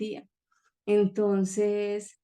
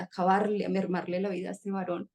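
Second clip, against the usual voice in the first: ordinary delivery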